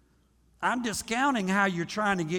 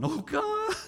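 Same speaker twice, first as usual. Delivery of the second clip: much higher mock wail of despair; ugly praying wail; 13 semitones higher, 3 dB quieter than usual. Preacher mimics needy believers crying out, hammed-up high wail. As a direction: wail it like a hammed-up desperate prayer. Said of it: "we do that ugly praying".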